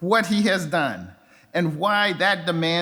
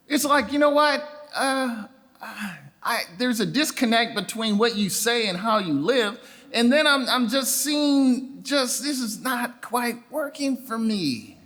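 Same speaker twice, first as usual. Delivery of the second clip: disillusioned youth; 5 semitones higher than usual. Retold or imitated. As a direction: sound let down by the church. Voicing disillusioned young churchgoers.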